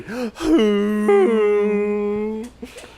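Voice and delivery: high, long mock sob like an engine; imitated crying wail. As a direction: wail in imitation of a revving car. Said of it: "Like that woman crying".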